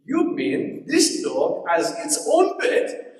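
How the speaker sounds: put-on slow, incredulous Rwandan accent; put-on Rwandan accent, drawn out in disbelief; imitated Rwandan accent. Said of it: "she said in this, this accent".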